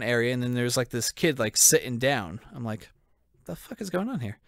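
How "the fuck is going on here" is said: higher, disbelieving question; baffled. Retold or imitated. Retelling the security stop, higher disbelieving question. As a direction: sound lost and baffled.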